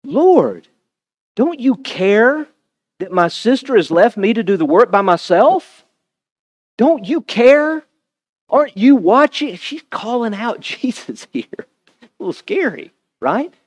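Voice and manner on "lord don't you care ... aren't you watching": higher, indignant complaint; frustrated Martha. Acted. Martha confronting Jesus over her sister, indignant. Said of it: "Look at what she says".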